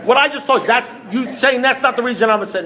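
high-pitched, indignant protest; acted-out incredulous sputter; exasperated disbelief. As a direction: argue back, voice pitched right up. Acted quarrel, escalating disbelief.

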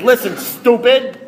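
high, slow, emphatic insult; acted-out sponsor losing patience; exasperated put-down. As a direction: spell it out for a fool. Sponsor snaps back in retelling.

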